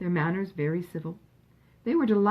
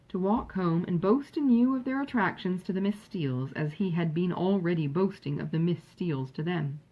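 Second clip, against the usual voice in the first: usual speaking voice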